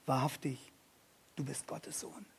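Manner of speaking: tonlos geflüstert, voller Staunen; flüstern wie vor etwas Heiligem; ehrfürchtiges Flüstern. Acted. Disciples worship in awe. (die Jünger flüstern anbetend vor Jesus)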